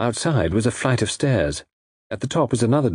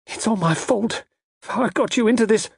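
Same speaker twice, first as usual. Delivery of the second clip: put-on very high, guilt-stricken young lady; pitched-up, self-blaming distress; distressed cry; 13 semitones higher than usual. A narrator's reading. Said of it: "cried Frida".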